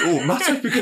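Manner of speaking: squealing high welcome; high camp voice. Pretend. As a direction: sing it out like a campy host. Playing host in bathrobe.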